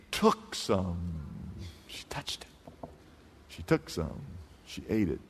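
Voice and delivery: preacher hushing the crowd at the fall; hushed dramatic telling. A narrator's reading.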